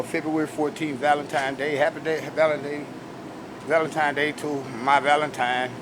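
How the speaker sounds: ordinary talk, nothing marked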